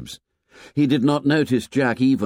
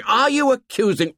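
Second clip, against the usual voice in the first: much higher, loud furious outburst; shouted in rage; 4 dB louder than usual. Acted. Jack rounding on Ralph, furious shout. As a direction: explode at him in sudden rage. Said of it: "Suddenly Jack shouted in rage".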